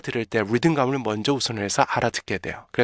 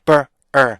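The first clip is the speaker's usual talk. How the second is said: "rip, map, 음, 버, 어" as detached syllables; loud staccato drill of remember's pieces; beat-by-beat breakdown; 6 dB louder, much slower than usual. Shown as a demonstration. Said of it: "이게 있잖아요".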